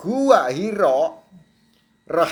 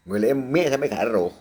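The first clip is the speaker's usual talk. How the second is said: ordinary talk, nothing marked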